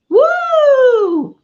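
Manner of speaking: high, loud cheer; high excited shout of joy; excited whoop. Real, not acted. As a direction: let out a big happy woo. Cheer before greeting comments.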